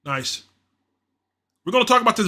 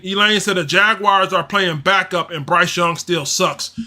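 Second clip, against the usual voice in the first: ordinary talk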